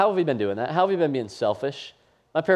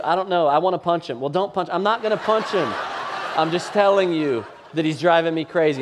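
exasperated dad; 5 semitones higher, 4 dB louder than usual. Retold or imitated. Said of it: "phone call".

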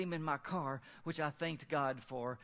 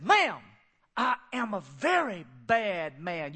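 menacing street man; 7 dB louder, slower than usual. Retold or imitated. Menacing homeless man threatens wife.

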